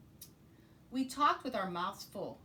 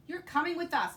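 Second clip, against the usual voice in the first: capitão pirata mandão; 9 semitones higher, 7 dB louder than usual. Acted. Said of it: "Braid Beard told me".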